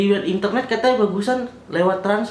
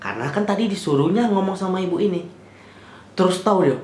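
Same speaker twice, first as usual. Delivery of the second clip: usual speaking voice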